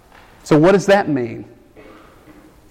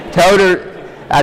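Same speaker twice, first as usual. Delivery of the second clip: laughing; 3 semitones higher, 6 dB louder, slower than usual. Real, not acted. Laughs at own joke.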